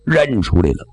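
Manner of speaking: ordinary delivery, nothing marked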